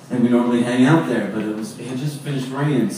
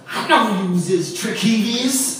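high, drawn-out proclamation; imitated knowing woman; 7 semitones higher, slower than usual. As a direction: mimic her proudly announcing a discovery. Acted woman's triumphant mangled claim.

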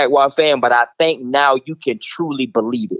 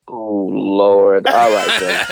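a lower mock cry of dread; mock despair; 8 semitones lower, much slower than usual. Fan hearing the Ravens come next, mock lament. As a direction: groan in playful dread.

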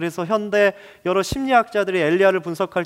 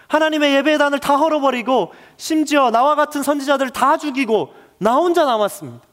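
anguished protest; 5 semitones higher than usual. Acted. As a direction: pour out the frustration of a prophet who has done enough. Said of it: "일상의 언어로 해석해 보면 이렇습니다".

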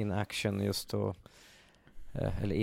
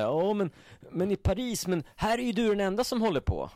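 much higher, put-on guard voice; imitating guard; 11 semitones higher, 3 dB louder than usual. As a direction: mimic the guard complaining. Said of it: "då säger han så här". (härmar vakten med ljus röst)

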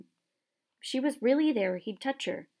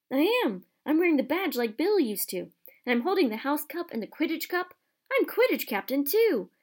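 louder, higher, tumbling out with delight; a boy bragging about his dream; rising thrill at his own glory; excited; 6 semitones higher, 4 dB louder than usual. Acted. Ron sees himself head boy.

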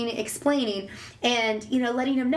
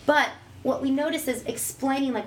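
usual speaking voice

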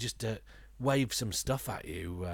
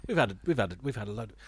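ordinary talk

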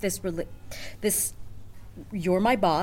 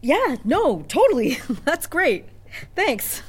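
loud, high gushing thanks; effusive, pitched up and emphatic; over-eager agreement; 9 semitones higher, 9 dB louder, much slower than usual. Acted. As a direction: cover the awkwardness with bright, emphatic enthusiasm. Covering awkwardness with over-eager agreement.

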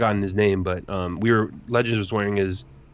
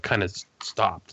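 normal talking voice

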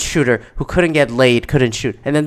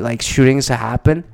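usual speaking voice

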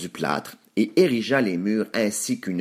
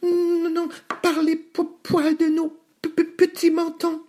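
very high squeaky stammering refusal; protesting piglets. A narrator's reading. Two pigs refusing the wolf at the door, squeaky protest. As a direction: protest in a tiny stuttering pig voice. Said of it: "protestèrent les cochons".

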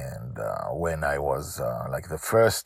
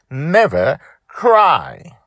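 very slow, high, loud never cry; slow intensity; 10 semitones higher, 11 dB louder, much slower than usual. Acted. Dragon Lil telling her father, slowly and with intensity. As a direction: drag out never cry with force. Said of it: "said slowly with intensity".

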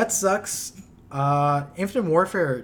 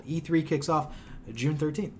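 ordinary talk

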